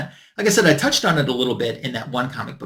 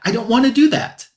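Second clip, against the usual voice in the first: higher, firm, pushed up on do; insistent refusal; 9 semitones higher than usual. Retold or imitated. Retelling refusing the card company's demand, insistent and higher. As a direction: refuse again, pitch climbing hard.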